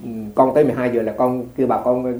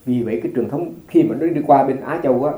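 ordinary talk, nothing marked

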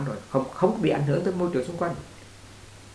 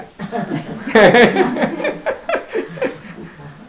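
loud, high laughing fit; real high belly laugh; hearty laugh; 9 semitones higher, 5 dB louder than usual. Real, not acted. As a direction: burst out laughing, long and loud. Laughs at own joke.

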